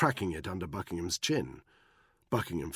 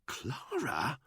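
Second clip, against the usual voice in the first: incredulous whisper; 8 semitones higher, 4 dB quieter than usual. Acted. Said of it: "he whispered incredulously".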